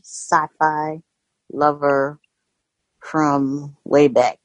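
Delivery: ordinary talk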